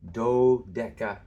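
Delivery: "dodeca" slow and loud, accent on the opening DO; stress on first syllable. Shown as a demonstration. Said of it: "Here the accent is on this first syllable".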